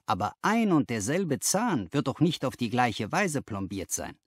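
ordinary delivery, nothing marked

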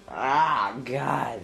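ordinary talk

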